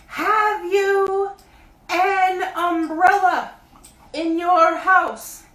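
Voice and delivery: high, loud bear calling down; Pooh voice. A narrator's reading. Pooh calling down to Christopher Robin, high bear voice. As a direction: call up from the honey tree like a bear.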